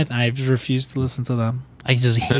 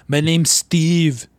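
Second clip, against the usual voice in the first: put-on slow, higher Austrian accent, laughing through it; mock Austrian accent; 4 semitones higher, much slower than usual. Cohost riffing on Arnold's bland names, Austrian accent. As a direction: stretch the name out in a heavy Austrian accent. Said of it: "with your fucking accent".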